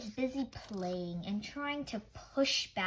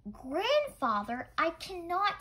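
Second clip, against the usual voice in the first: higher, louder protest; child's cry; 7 semitones higher, 5 dB louder than usual. Acted. Child cries out to grandfather, high and loud protest. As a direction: plead loudly against bedtime. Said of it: "Miyuki cried".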